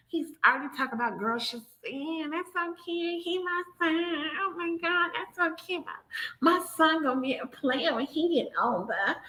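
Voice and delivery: high, soft put-on mother; mock doting mom. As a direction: coo over the son like a proud, clueless mom.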